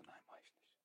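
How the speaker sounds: tonlos geflüstert; flüstere die Absage zu dir selbst; geflüsterter Gedanke. Retold or imitated. Innerer Entschluss zitiert. (geflüsterte Absage)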